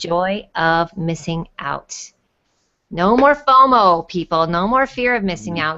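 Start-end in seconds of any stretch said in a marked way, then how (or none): 0.0-1.9 slow explaining. Shown as a demonstration. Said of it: "Does everybody know what jomo is?". 2.9-4.5 rallying call. Real, not acted. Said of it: "rallying the chat".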